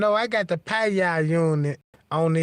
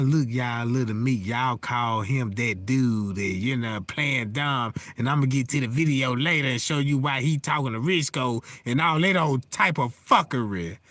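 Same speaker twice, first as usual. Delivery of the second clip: mocking the other guy; 8 semitones lower than usual. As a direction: imitate him playing dumb, pitched down and muttered. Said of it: "he was trying to tell y'all".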